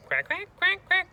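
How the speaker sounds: very high, loud quacks; squeaky duck calls; duck quacking. Acted. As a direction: quack excitedly like little ducks. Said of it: "this little duck comes and goes".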